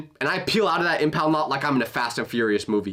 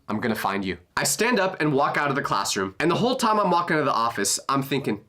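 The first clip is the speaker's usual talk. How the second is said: normal talking voice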